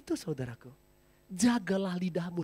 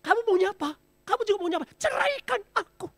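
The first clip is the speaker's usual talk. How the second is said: high, louder mock shrill quarrel; quarreling wife; 6 dB louder than usual. Wife shouting at her husband during a quarrel, mock angry. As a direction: play the nagging wife mid-fight. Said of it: "Setiap kali bertengkar dengan suami".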